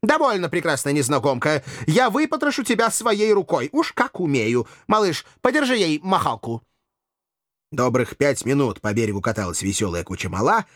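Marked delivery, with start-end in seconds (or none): 0.0-6.6 higher-pitched huffy threat; affected petulant bravado; childishly offended. A narrator's reading. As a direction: declare vengeance like a sulky gallant. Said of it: "обиделся, словно ребёнок".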